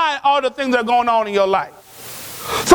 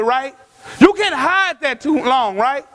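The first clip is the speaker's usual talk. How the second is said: ordinary delivery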